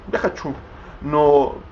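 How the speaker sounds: ordinary delivery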